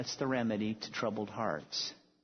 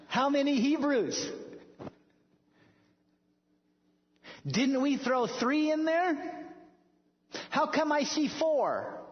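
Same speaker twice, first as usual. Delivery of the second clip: astonished king; 11 semitones higher than usual. Retold or imitated. Nebuchadnezzar to his guards, high and astonished. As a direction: gape at the furnace in disbelief.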